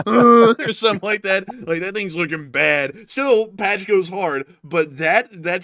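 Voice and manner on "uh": loud mock grunt; hammed-up impaled groan. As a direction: grunt as if stabbed. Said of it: "got impaled with".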